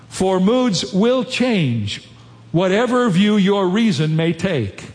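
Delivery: measured, slow reading of a quoted passage; quotation reading. Acted. Pastor reading C S Lewis to the congregation, measured. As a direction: read the Lewis passage with weight.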